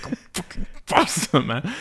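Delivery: impatient mock grumble at the game; frustrated. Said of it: "getting really frustrated".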